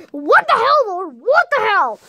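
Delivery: high, exasperated cry; angry, high-pitched exclaiming; mad outburst. Acted. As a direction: cry out in anger and disbelief. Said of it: "how I can be even madder".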